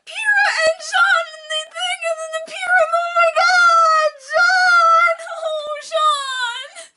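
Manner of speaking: very high, crying wail; sobbing fan wail. Real, not acted. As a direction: wail through fan grief. Fan grieving a character.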